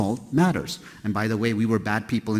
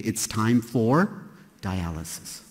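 normal talking voice

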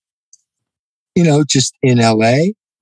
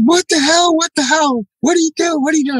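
panicked yelling. Retold or imitated. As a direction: squeal it like you're being thrown onto the couch. Friend being slammed on couch.

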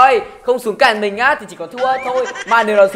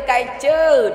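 "cay chứ" pitched higher, falling at the end in a mocking sing-song; mocking taunt; 4 semitones higher than usual. Shown as a demonstration. Gamer rubbing it in to the enemy team, higher falling jeer. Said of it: "mình sẽ cà khịa hành động".

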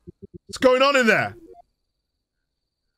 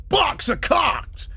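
mock voice; 9 semitones higher, much slower than usual. Reading graffiti in a voice.

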